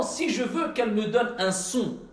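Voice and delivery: ordinary delivery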